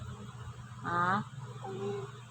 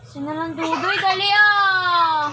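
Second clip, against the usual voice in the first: calling out; 4 semitones higher, 9 dB louder than usual. Real, not acted. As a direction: holler it, stretching the ending. Calling out to someone named Dudoy.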